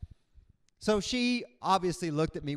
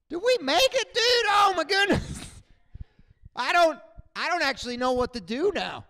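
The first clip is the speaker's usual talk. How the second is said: much higher and louder, shouting in disbelief; stunned elated pilot; 11 semitones higher, 8 dB louder than usual. Retold or imitated. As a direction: yell like you just cheated death. Pilots stunned after surviving.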